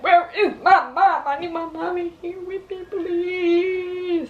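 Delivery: high, tearful cry for her mom; terrified plea. Retold or imitated. Alone in the operating room, begging for her mom, high and tearful. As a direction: call for your mommy like a scared child, breaking into a cry. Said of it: "It was terrifying".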